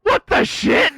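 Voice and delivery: high, loud, shocked outburst; shocked exclamation. Real, not acted. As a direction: blurt it out stunned. Bot says breastfeeding.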